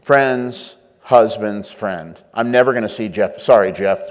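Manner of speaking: slow, low and soft, shrugging Jeff off; a slow, low brush-off of Jeff; brain's dismissive voice. Retold or imitated. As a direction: drawl it out like it doesn't matter. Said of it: "Your brain says".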